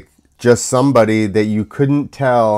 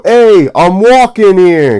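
loud, slow, higher Noo Yawk shout; New York yell; 9 semitones higher, 9 dB louder, slower than usual. Retold or imitated. Pedestrian yelling at a car driving down the road, loud New York shout. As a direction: bellow it at the car like a cabbie. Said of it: "the guy would go".

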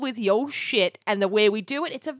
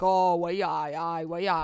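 slow, lower Geordie drawl; burly old Geordie man; 4 semitones lower, slower than usual. Retold or imitated. Imitating a burly old Geordie man, slow and low. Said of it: "a burly old Geordie man".

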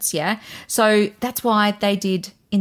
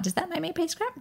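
questioning; 9 semitones higher, 9 dB quieter, faster than usual. Shown as a demonstration. Questioning the critic's words.

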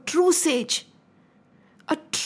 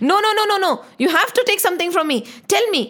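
insistent pleading; 7 semitones higher, much faster than usual. Acted. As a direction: insist urgently like an eager student. Disciple insisting urgently.

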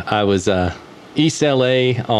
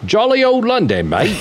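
put-on bigger, higher over-the-top British accent; mock British accent; 12 semitones higher than usual. Host retrying his London band intro in a hammed-up British accent. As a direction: ham up a jolly London geezer. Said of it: "the impersonation I did, the little accent".

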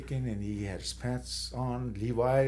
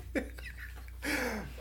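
high overcome laugh; 13 semitones higher, 4 dB quieter than usual. Real, not acted. Laughing while retelling a happy memory.

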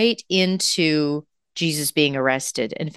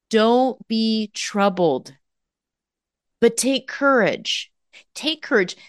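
slow, higher pleading to take courage; earnest exhortation; 5 semitones higher, slower than usual. Acted. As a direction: lean in and urge them, slowly. Preacher urging the faithful.

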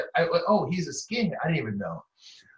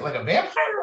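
much higher, louder, disbelieving question; incredulous; 14 semitones higher, 4 dB louder than usual. Retold or imitated. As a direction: ask it as if you can't believe your ears. Reacting to the skinhead's remark.

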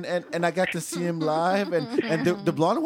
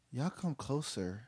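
slow hushed creepy invite; creepy come-closer voice; 10 dB quieter, slower than usual. Retold or imitated. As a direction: lure them in slowly and softly. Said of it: "with that voice that you guys put on".

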